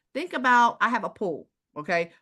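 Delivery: normal talking voice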